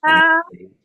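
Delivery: very high, loud squeal on "beat"; over-the-top tutor impression, "beat" shrieked high; Andrew Davidson. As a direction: send "beat" right up to the ceiling. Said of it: "he was always emphasized that".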